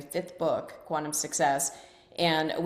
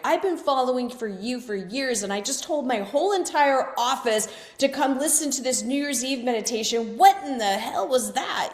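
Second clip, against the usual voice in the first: upset longtime follower; 7 semitones higher, 4 dB louder than usual. Retold or imitated. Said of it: "she's like".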